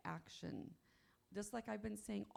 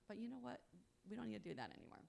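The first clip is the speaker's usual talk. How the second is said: usual speaking voice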